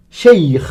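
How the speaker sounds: "Cheikh" with a long rasped kh ending; Arabic pronunciation. Shown as a demonstration. Teacher models the word for learners, "Cheikh" with guttural final kh. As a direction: let "Cheikh" end in a throaty scrape. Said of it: "c'est pour représenter ce son".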